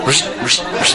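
breathy rush sound of something moving; soft imitated rustle; a soft rush, rush noise mimicking a sound; rustling noise. Shown as a demonstration. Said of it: "it goes".

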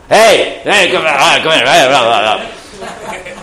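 high, loud rough calling; rough village shouting. Acted. Village lady calling out, rough and loud mock shouting. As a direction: holler like a rough villager, hammed up. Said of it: "a little louder".

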